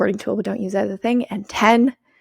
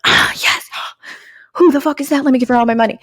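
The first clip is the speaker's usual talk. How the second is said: gasping fan; 4 semitones higher, 6 dB louder than usual. Pretend. Mock fan reacting to influencer.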